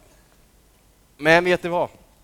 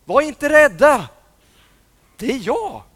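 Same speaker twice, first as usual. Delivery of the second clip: calling out; 9 semitones higher, 10 dB louder than usual. Acted. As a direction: call out to reassure them. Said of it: "en röst som ropade".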